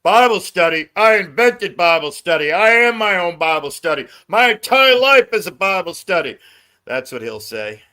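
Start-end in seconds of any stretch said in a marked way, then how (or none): 0.0-6.4 imitating Connie. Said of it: "Connie's gonna say".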